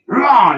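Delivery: high, loud battle roar. Acted. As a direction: roar as you pounce. Lunging at the knight.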